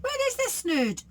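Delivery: a greedy crook asking; Len's voice. Acted. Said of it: "asked Lanky Len".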